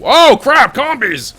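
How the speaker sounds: loud, high alarmed shout; startled loud yell at the attackers. Real, not acted. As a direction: yelp as they jump you. Ambushed in game.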